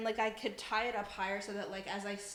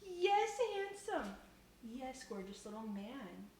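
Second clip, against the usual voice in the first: cooing at pet; 8 semitones higher, 5 dB quieter, slower than usual. Real, not acted. Streamer to her gorgeous little man the cat, high cooing. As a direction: coo adoringly in a high, drawn-out voice.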